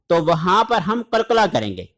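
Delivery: usual speaking voice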